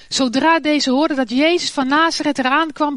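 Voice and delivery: ordinary delivery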